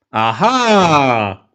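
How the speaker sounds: drawn-out, hammed-up "aha" of discovery; mock realisation. Host reacting to the revealed motive, mock realisation. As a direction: exclaim as if the catch suddenly dawns.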